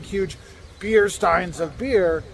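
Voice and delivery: usual speaking voice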